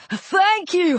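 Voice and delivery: high, delighted yell; elated parting shout. A narrator's reading. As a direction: call back in a high voice. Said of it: "he yelled".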